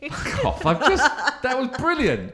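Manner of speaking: laughing high through the words; real laughter, high-pitched. Real, not acted. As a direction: break up laughing in disbelief. Cracks up at the reply.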